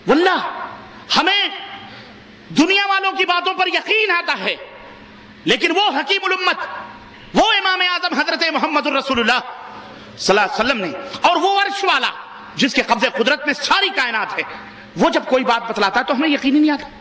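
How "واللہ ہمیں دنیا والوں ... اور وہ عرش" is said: raised high, fervent preacher's cry; impassioned preaching. Real, not acted. Preacher urging his congregation, impassioned raised cry. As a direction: pitch climbing in fervent appeal. Preacher rising to emotional climax.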